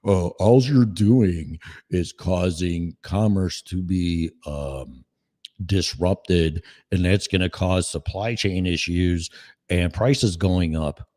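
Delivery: mocking Trudeau, slow and low; mock leader. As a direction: drone it out like a smug leader. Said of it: "fearless leader come up and go".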